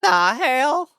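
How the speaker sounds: high, loud crack on "The hell". Shown as a demonstration. Streamer showing viewers how to say it, voice cracking high. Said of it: "Kinda crack your voice a little bit".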